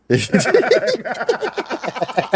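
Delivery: a sudden high burst of laughter. Real, not acted. Laughing at the track.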